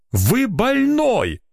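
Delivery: drawn-out, very high exclamation; outraged realization. A narrator's reading. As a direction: cry out the discovery slowly, high. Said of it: "наконец догадался он".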